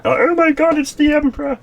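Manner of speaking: very high, loud mock-excited voice; giddy fanboy squeal. Happy follower spotting the Emperor, giddy squeal. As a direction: squeal it like a star-struck follower.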